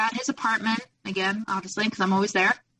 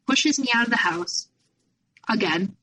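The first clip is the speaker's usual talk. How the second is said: ordinary talk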